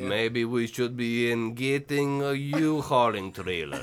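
slow, low Russian mother voice; fake Russian accent. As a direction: draw out broken English as a Russian mom. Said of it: "substitute that your mother is Russian".

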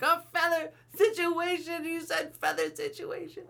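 very high, slow incredulous squeal; laughing lady. Retold or imitated. Lady laughing at the phrase, high incredulous squeal. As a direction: squeal it in disbelief. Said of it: "She was like".

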